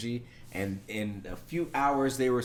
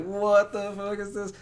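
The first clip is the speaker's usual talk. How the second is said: high, slow disbelief; stunned by a scam call; incredulous; 9 semitones higher, 4 dB louder than usual. Retold or imitated. Reacting to a spam voicemail, high and incredulous. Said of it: "I was like".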